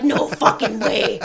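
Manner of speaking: loud, drawn-out disbelief; retold stunned disbelief. Group answers niece at the window, retold loud slow disbelief. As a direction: stretch it out slowly, reacting in mock amazement.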